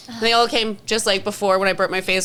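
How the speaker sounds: ordinary talk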